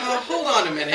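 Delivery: loud, high-pitched playful objection; playful protest. A narrator's reading. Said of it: "I say, pulling back playfully".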